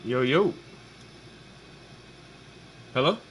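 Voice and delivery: normal talking voice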